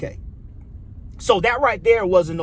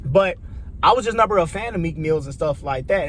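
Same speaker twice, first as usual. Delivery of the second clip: ordinary talk, nothing marked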